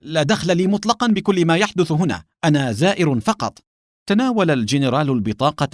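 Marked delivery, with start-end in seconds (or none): 0.0-3.6 high-pitched anxious protest; urgent disclaimer, pitched up; hurried cry. A narrator's reading. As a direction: cry out to deny any involvement. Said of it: "وأسرع حاييم يصيح".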